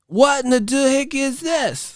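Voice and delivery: high, silly indignant voice; high-pitched caricature of outrage; mock old-media complainer. Repeats the mocking media voice.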